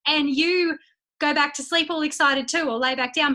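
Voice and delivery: ordinary delivery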